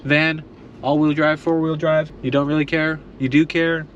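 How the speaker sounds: ordinary delivery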